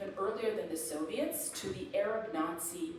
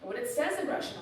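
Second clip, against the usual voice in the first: usual speaking voice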